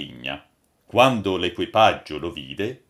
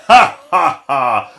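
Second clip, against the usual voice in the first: loud, high stage laugh; acted laughing; 5 semitones higher, 12 dB louder, slower than usual. A narrator's reading. Old master seeing his audience gathered, laughing loudly. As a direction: burst into a big acted "ah, ah, ah". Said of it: "si mise a ridere".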